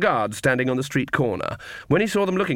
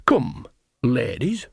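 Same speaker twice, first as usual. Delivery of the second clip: grand dismissal; much slower than usual. Acted. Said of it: "She flourished her broomstick".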